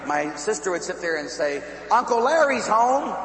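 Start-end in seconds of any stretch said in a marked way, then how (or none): 1.9-3.2 high, loud household announcement; calling out. Retold or imitated. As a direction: call the child over, as a retold sister. Sister calls out to the niece.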